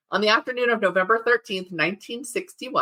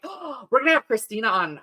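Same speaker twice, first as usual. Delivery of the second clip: excited gasp; 5 semitones higher than usual. Retold or imitated. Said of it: "Is I was like".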